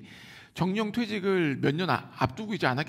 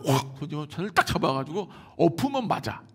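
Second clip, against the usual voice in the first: usual speaking voice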